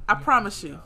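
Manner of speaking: usual speaking voice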